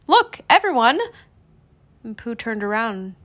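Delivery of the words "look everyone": high, raised-voice call; excited call. A narrator's reading. Pooh calling his friends to look, excited and high. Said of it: "Pooh cried".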